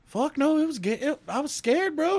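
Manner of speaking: high, loud, mock-spooked coworker; mock scared coworker. Coworker retelling the shower scare, mock scared. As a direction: sell how rattled he was. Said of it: "he goes".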